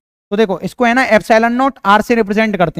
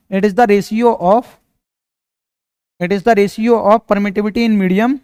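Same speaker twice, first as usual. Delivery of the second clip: dictation; much slower than usual. Shown as a demonstration. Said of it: "लिख लो यहाँ पे".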